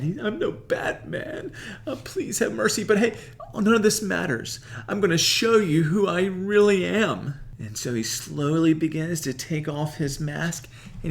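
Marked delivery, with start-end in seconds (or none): 0.2-7.3 much higher, laughing, growing louder; hammed-up Peter. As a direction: ham it up, then turn bold. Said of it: "kinda contrasting him to Batman".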